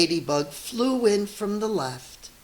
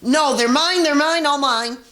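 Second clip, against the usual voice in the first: high, loud possessive scream; grouchy scream; 10 semitones higher, 8 dB louder than usual. Acted. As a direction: shriek that it's all yours. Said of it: "screamed the grunchy ladybug".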